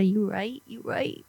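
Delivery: trailing off into a whisper; fading to a whisper. Real, not acted. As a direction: drop to a whisper. Echoes whispering host.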